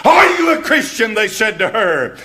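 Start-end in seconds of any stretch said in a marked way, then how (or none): 0.0-0.8 a much higher, louder accusing yell; mob scream. Retold or imitated. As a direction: scream the question in her face like the mob. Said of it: "They screamed into her face".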